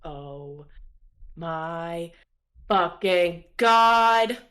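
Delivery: slow, word by word, swelling to loud; building awestruck exclamation; stunned disbelief. Acted. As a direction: start low and stretch out the last word. Stunned at seeing Rose.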